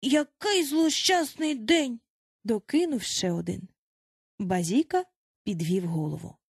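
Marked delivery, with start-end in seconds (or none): 0.0-2.0 slow, high wailing; woeful lament. A narrator's reading. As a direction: draw the words out mournfully, pitch raised. Another boy laments the disaster.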